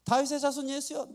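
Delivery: high, insistent call. Acted. Bartimaeus calls Jesus despite the crowd, insistent acted cry. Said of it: "더욱 크게 소리 지른 거예요".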